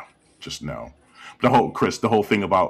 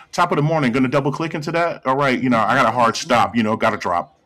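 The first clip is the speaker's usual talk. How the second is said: louder, higher pretend office chatter; mock corporate jargon; 5 semitones higher, 5 dB louder than usual. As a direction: play a lightly mocking corporate worker. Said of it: "just corporate jargon".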